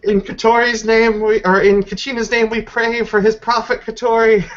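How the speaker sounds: high, loud solemn invocation; mock prayer. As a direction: intone it like a fervent prayer. Character prays aloud mid-scene.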